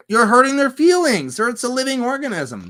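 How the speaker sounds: high, loud indignant outburst; mock scolding. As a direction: scold in hammed-up outrage. Mock scolding people who yell at trees.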